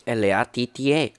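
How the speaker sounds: "L A T T E" letter by letter; each letter of "L A T T E" named separately; spelled out. Acted. Spells the word out.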